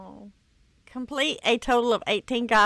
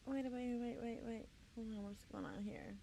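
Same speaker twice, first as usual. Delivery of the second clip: much softer, almost under the breath; hushed; 18 dB quieter, faster than usual. Real, not acted. Sneaking up in the game, hushed. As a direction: hush it like you're sneaking up.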